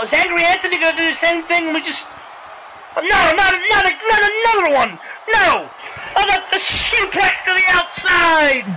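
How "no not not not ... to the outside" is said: high, urgent protest; protest, then draw out the big move; alarmed move call. Real, not acted. Commentator dreading another suplex outside, high alarmed call.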